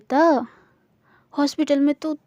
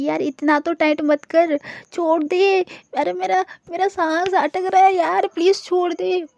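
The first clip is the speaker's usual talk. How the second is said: higher, strained voice of someone hugged too tight; higher strained plea to loosen a tight hug; squeezed breathless; 5 semitones higher than usual. Acted. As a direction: gasp it out as if your breath is being squeezed. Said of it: "आस्था का दम जो है वो घुटने लगता है".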